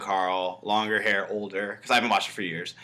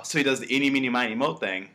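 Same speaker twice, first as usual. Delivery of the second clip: ordinary talk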